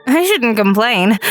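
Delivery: ordinary delivery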